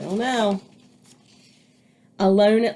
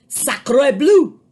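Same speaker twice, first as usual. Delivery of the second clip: put-on high, loud French-accented cry; a dramatic French duck; French duck exclamation; 6 semitones higher, 9 dB louder than usual. A narrator's reading. Duck spots the nest, loud French cry. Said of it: "she cried".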